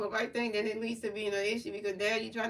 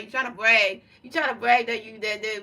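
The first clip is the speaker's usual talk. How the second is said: loud accusation; 9 dB louder than usual. Real, not acted. Scolding chat member about bragging.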